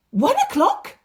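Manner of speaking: high, loud astonished rise; amazed question. Acted. Father realising the hour matches Jesus' word, amazed. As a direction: catch your breath at the hour. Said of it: "father's amazed question".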